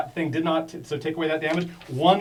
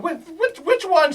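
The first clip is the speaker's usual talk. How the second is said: high, questioning monster voice; monster's question; 14 semitones higher, 6 dB louder than usual. Acted. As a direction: pitch it way up as the creature picks a target. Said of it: "she says".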